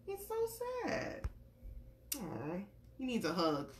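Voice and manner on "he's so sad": ordinary delivery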